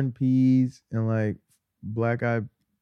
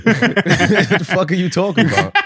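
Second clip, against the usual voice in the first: laughing out the question, slowed and higher; cracking up while asking, real laughter; laughing disbelief; 6 semitones higher, 5 dB louder than usual. Real, not acted. Friend laughing at a joke about his sneakers, laughing.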